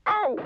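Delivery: very high startled yelp; sharp squeal of alarm; alarmed squeak. A narrator's reading. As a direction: squeak as if suddenly tickled. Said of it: "squeaked Mister Grumpy in alarm".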